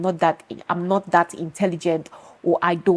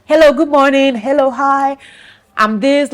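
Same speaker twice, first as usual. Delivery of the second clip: higher, louder mocking voice of people who don't get it; mocking other people; 7 semitones higher, 6 dB louder than usual. [name] imitating people who think talking is easy, in a higher, louder mocking voice. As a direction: mimic them as if it's all so easy. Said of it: "They're just like".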